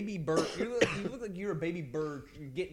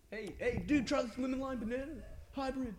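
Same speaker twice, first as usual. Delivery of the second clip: excited pitch; 9 semitones higher than usual. Retold or imitated. Retold pitch of banana idea.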